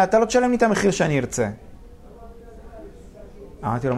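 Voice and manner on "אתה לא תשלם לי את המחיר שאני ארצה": mock yell, raised and high; retold screaming. Owner screaming at the buyer over price, mildly hammed-up high yell. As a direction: imitate an owner hollering back. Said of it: "בזמן שהוא צורח עליי".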